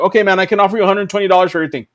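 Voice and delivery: fast, hurried offer; rushed. Retold or imitated. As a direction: rush through the numbers. Hurrying to end transaction.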